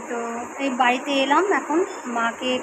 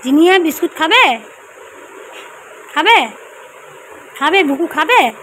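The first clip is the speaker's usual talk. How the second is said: loud, high sing-song questions; coaxing call; 4 semitones higher, 8 dB louder than usual. Real, not acted. Asking Jinia if she'll eat biscuit, coaxing call. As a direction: offer a treat in a raised, lilting voice.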